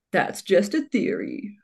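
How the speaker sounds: slow, lightly put-on YouTuber sign-off; a rough imitation of a host's slow catchphrase; Matt Pat. Quoting how he always ends his videos, lightly imitated. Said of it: "that was a horrible imitation of him".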